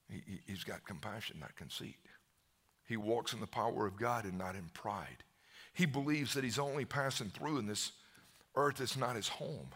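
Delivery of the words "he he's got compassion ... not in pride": ordinary delivery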